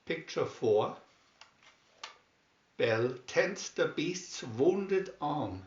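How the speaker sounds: ordinary delivery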